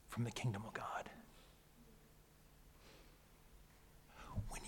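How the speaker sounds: whispered, intimate; hushed preacher's whisper. Real, not acted. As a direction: drop to a whisper for the promise.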